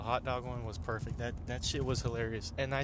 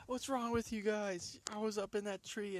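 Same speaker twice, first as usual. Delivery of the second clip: retold indignant complaint; 9 semitones higher than usual. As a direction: act out an aggrieved protest. Retold angry complaint after falling.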